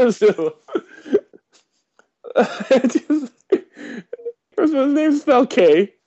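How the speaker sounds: high, laughing through the words; can barely get it out for laughing. Real, not acted. Laughing while telling a friend how K spells his name.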